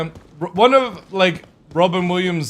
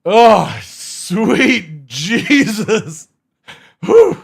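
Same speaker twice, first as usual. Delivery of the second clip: shocked; 7 semitones higher, 10 dB louder, much slower than usual. Real, not acted. Reacts to the picture.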